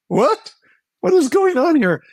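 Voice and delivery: high, loud incredulous cry; disbelief. Retold or imitated. Speaker voicing the woman's bewildered outrage, incredulous. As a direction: demand to know what's happening. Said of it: "speaker exclaiming in disbelief".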